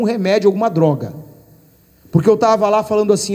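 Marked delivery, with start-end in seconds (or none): none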